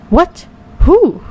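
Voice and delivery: high-pitched startled squawk of a question; funny voice. A narrator's reading. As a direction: voice jumps up, all funny. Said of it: "his voice goes all funny".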